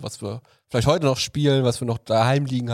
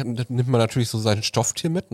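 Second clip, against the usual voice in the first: normal talking voice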